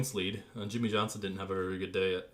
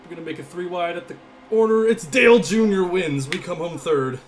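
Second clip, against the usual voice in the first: loud, high shouted race call; excited finish call; 8 semitones higher, 12 dB louder than usual. Real, not acted. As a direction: build to a shouted winning call. Calling the race finish live.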